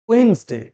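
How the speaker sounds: ওয়েডনেসডে shrunk to "wens-de", first d silent; corrected Wednesday. Shown as a demonstration. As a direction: say ওয়েডনেসডে in two syllables, "WENZ-day". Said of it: "কি হবে?". (ওয়েডনেসডে-র সঠিক উচ্চারণ, "ওয়েন্স-ডে")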